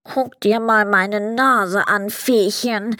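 slow, congested head-cold voice; stuffed-up Sandman. A narrator's reading. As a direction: talk as if your nose is blocked. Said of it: "sagte der Sandmann verschnupft".

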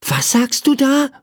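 put-on high-pitched curious little boy. A narrator's reading. Boy questions the bird at his window, curious. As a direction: ask it high, eager to find out. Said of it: "fragte ihn Michael neugierig".

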